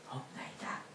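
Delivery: a small unvoiced admission; whispered answer. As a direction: breathe the answer in a bare whisper.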